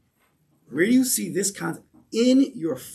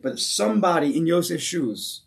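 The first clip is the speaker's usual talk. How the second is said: usual speaking voice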